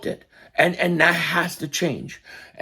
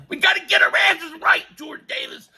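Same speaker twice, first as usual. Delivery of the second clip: shouting; 11 semitones higher than usual. Real, not acted. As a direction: yell it out, fired up. Yelling at Jordan Davis about shape.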